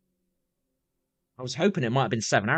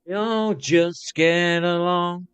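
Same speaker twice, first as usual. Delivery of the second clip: slow drawn-out plea; much slower than usual. Pretend. Mock pleading with chat.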